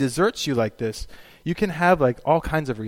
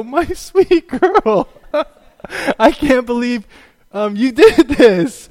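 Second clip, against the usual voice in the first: very high, slow and loud, broken by laughs; half-laughing, half-wailing disbelief; mock devastated; 10 semitones higher, 9 dB louder, slower than usual. Father to his lying daughter in the car, laughing mock wail. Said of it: "I was, like, devastated".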